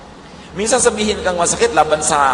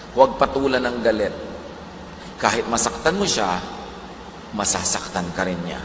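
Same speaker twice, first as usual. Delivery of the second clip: normal talking voice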